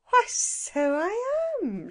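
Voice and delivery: put-on high, slow, cheerful old lady; transformed woman, all sweetness, high and slow; jolly woman. A narrator's reading. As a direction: beam with sudden good humour.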